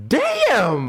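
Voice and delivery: high, drawn-out exclamation; mock shock. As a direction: react as if stung on someone's behalf. Acted shocked reaction to the insult.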